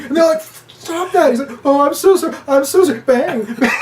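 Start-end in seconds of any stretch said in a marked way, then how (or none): 0.8-1.3 high, loud scold. Retold or imitated. Scolding Jonah Hill. 1.6-3.7 high, loud fake sorry; fake apology. Mock apology.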